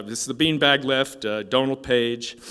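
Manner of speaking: loud announcer calling out a competitor. Retold or imitated. Announcer introducing the competitor to the crowd, loud announcer call. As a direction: call it out to the whole room. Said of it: "the announcer says".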